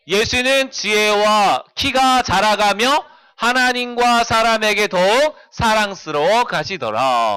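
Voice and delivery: slow, drawn-out scripture recitation; reading aloud. Shown as a demonstration. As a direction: read the verse slowly so children can follow along. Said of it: "읽어볼까요?".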